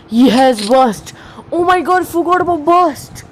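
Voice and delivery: high, startled gamer yelp; alarmed outburst. Real, not acted. Gamer caught by burst fire, alarmed outburst. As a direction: cry out as danger suddenly hits. Sudden alarm during a fight.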